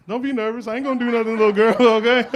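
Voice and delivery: high-pitched, laughing reassurance; teasing reassurance. Real, not acted. Comic to a nervous girl in the crowd, teasing reassurance. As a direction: tease gently in a high voice, ending loud.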